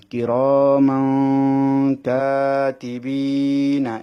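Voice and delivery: very slow chanted কিরামান কাতিবিনা; measured tajweed chant; Quran recitation. Shown as a demonstration. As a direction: recite it like in the mosque, stretching the alif. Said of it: "এখানে এক আলিফ টানতে হবে".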